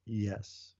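a very quiet, drawn-back "yes"; jokingly hushed, as if not to be overheard; hushed confession. Pretend. Husband admitting it in case his wife is listening, very quietly. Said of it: "say that in a very quiet voice".

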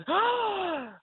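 sharp high sharp intake of breath; imitated gasp. Cashier struck silent by the story, sharp gasp. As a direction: mimic her shocked gasp. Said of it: "took this huge breath of".

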